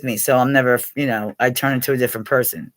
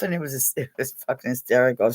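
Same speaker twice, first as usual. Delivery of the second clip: usual speaking voice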